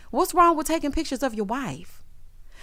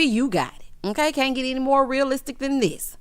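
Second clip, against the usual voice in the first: usual speaking voice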